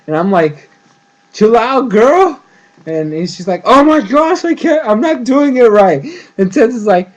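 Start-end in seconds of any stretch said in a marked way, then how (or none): 1.3-2.4 high, loud telling-off; mock exasperation. Viewer telling twitching Korra to relax, mock exasperated. 3.6-6.0 high, loud mock whine; retold frustration. Korra failing at meditation, mock frustrated outburst.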